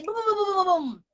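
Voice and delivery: high, rattling run of boums; imitating rapid-fire speech. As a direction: fire off the syllables in one burst. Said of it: "très vite".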